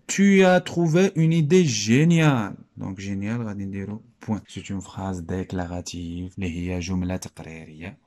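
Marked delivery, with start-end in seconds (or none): 0.1-2.6 loud, high, slow declaiming; theatrical classroom read-aloud of praise; emphatic exclamation. Acted. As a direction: call it out with stretched, punched words. Acting out the quoted exclaimed line.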